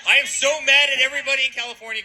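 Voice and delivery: building frustration boiling over. Real, not acted.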